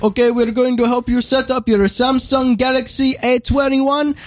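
high, mock Indian accent; hammed-up Indian customer service voice; imitating Indian call agent. Caller mimics the polite agent, in a mock Indian accent. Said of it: "He's like".

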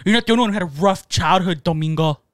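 normal talking voice

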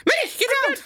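high, urgent cartoon mouse; Mickey Mouse voice. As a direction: warn Minnie as Mickey. Mickey about to fight.

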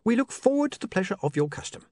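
bright. A narrator's reading. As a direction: brightly usher an important guest out. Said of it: "said William brightly".

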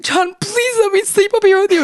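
put-on high, pleading, childlike whine; whiny plea. Wife begging John to let her sleep upstairs, high whine. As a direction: beg him sweetly.

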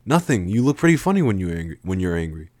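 ordinary delivery

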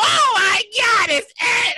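high, drawn-out mock fan cry; hammed-up squeal of awe; fanboy shriek. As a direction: act out a starstruck fan, stretching the words. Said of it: "No, I was a fanboy".

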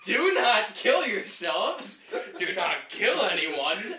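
loud, slow, word-by-word plea; loud, drawn-out admonishment; emphatic warning. Real, not acted. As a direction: hammer each word at the viewers. Mock stern warning to viewers.

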